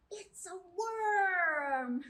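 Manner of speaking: gasp, then slow and loud; hammed-up discovery; mock surprise. Librarian shows the children the worm, gasping in mock surprise.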